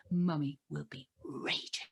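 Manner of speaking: low-pitched mock warning; low, mock-menacing mum; strict mum. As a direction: lay down the law like a strict mother. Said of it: "I'm really strict".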